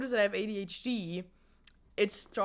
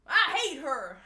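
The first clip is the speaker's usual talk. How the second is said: acted teen me; 6 semitones higher, 5 dB louder, slower than usual. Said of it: "And then me".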